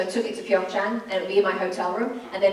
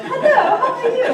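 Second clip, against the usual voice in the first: high, loud hammed-up British greeting; exaggerated squeaky-high British hello; mock British accent; 9 dB louder than usual. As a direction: imitate a British accent, pitched way up. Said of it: "They all slipped into the British accent".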